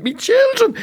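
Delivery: very high, anguished mum's cry; mother clutching her kids, wailing; dramatic mum. Retold or imitated. Mum grabbing her kids home from pub, high anguished cry. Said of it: "me mum just grabbed us and went".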